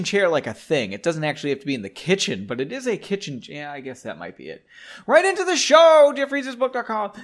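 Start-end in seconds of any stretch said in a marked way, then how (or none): 5.1-7.1 high, loud announcer shout; show catchphrase. Pretend. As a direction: belt out the show's catchphrase like a jingle. Show's shouted catchphrase intro.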